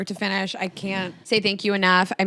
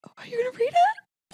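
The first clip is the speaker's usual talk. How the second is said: hushed whisper, half-embarrassed. Real, not acted. Friend whispers as the card is about to be read. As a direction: whisper it so only she hears.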